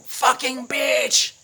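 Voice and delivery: slow, loud, high shouted insult; retold angry shout. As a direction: hurl it back at her in a fight. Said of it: "and I go".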